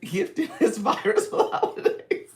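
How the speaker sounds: high, loud, through laughter; laughing. Real, not acted. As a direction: crack up laughing while talking. Laughing at her own joke.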